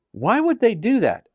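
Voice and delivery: put-on a boy who can't believe it; puzzled child. Caleb, a child, asks uncle.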